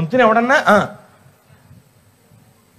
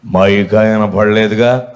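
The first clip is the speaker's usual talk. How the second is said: much lower, slow, put-on old woman's voice; old woman's reply; 11 semitones lower, slower than usual. Preacher imitating the absent old woman, low and slow. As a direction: play the stubborn old lady, low and dragging. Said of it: "అంది".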